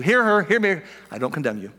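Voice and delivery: ordinary talk, nothing marked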